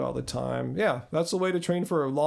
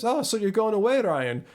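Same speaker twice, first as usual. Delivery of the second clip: higher-pitched Scottish friend; Scottish accent; 6 semitones higher than usual. Retold or imitated. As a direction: put on a light Scottish lilt. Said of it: "did you like my little Scottish accent there".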